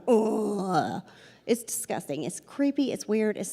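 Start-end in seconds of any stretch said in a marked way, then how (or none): 0.1-1.0 mock groan of air in a pipe; imitated groan. Showing how faulty plumbing sounds, imitated groan. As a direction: groan like a creaky water pipe. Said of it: "sounds like somebody's groaning".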